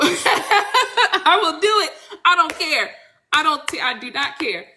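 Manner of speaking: high, laughing admission; giggly, high-pitched insistence; laughing defiance. Real, not acted. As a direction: own up shamelessly through a laugh. Defiantly owning up while laughing.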